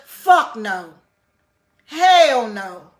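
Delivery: loud, forceful dismissal; loud scornful refusal; loud emphatic no. Real, not acted. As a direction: shout it down flat out. Emphatic denial of prison bragging.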